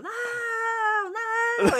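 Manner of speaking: high, drawn-out wailing no; mock desperate yell. Prankster fakes despair at the closed gate, wailing. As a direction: stretch it out like a crying shout. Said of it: "Saí gritando".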